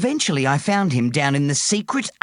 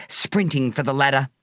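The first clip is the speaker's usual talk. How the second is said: ordinary delivery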